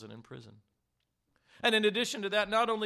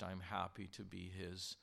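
ordinary talk, nothing marked

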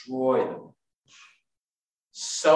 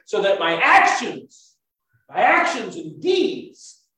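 full force preaching; 7 semitones higher, 6 dB louder than usual. Acted. Preacher urging the congregation toward total surrender, fervent. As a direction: drive the point home to the congregation.

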